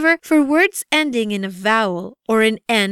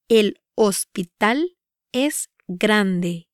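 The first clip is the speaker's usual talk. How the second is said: "El hospital es grande" with pauses between words; "El hospital es grande" measured, word by word; slow pronunciation demo; slower than usual. Shown as a demonstration. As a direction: break "El hospital es grande" up for learners. Example repeated broken up for learners.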